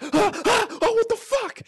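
loud high-pitched shrieks of shock; startled scream. Acted. Actor suddenly spots an alligator beside him, shrieking. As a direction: yelp twice, then cry out in disbelief.

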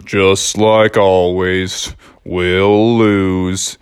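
low, slow, mopey donkey voice; gloomy Eeyore. Acted. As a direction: sigh it out like Eeyore.

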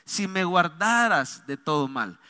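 slow, soft supplication; pleading. Shown as a demonstration. Pastor showing the tone of Jabez's prayer, slow soft plea. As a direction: plead slowly, as a humble request to God. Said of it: "ese tono, ¿verdad?, de súplica".

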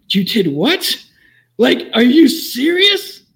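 high, loud, slow incredulous outburst; stunned disbelief. Retold or imitated. Officer reacting to the driver's lame excuse, loud disbelief. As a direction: let the disbelief explode, stretch it out. Officer hears why she fled.

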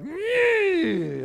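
high, loud burst of a shriek; sudden angry yelp. Retold or imitated. Losing your cool with a spouse, sharp high yelp. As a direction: let the temper snap out in one yelp. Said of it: "you let something out".

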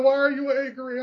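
high-pitched imitation of a roommate; voiced small roommate. Four-foot-eleven roommate asks while being shaken, high mock voice. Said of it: "she's like".

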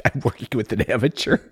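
huffy, put-upon pro; frustrated pro. Retold or imitated. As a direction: play the exasperated veteran host. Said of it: "You're just so frustrated".

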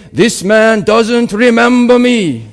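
put-on loud, high, slow African accent. Conference speaker pointing out a man to the audience, loud African accent. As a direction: declaim it in a booming African accent. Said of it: "in obviously African accent".

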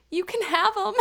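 high, pleading imitation of a drunk; mock drunken pleading, pitched high; drunken tantrum. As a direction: whine it like a child in a mild tantrum. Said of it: "like, a tantrum".

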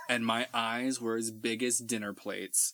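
usual speaking voice

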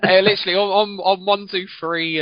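high flustered protest; embarrassed exclaim. Real, not acted.

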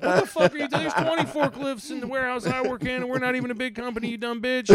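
high, loud, fast outraged rant; mock furious commenter. Angry stranger yelling at the troll, mock rage. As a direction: flip out like a furious forklift worker. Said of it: "people just flip out".

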